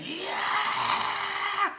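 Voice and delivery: long, very high, loud yell; retold scream. Storyteller reenacts screaming as the woman pummels him, high screaming. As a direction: shriek it out high, acting out your own panic. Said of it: "I'm in there screaming".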